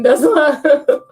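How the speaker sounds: laughing through words. Real, not acted. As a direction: giggle it out. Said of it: "I'm laughing".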